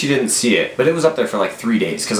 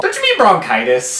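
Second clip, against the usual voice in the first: high, startled blurt; high startled blurt of a secret slip; surprised blurt; 12 semitones higher, 3 dB louder than usual. Retold or imitated. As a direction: blurt it out before thinking.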